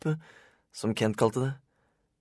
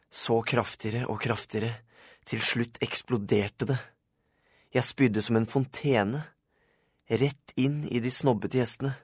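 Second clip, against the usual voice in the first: usual speaking voice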